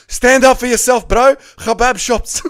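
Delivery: high, loud hollered cheer breaking into laughter; mock rallying shout. Streamer rallying the wogs in chat, mock shout. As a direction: bellow it as a joke battle cry.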